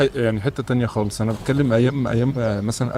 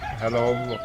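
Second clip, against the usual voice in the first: far from mic; 3 semitones lower, 9 dB quieter than usual. Real, not acted. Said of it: "بعيد عن المايك".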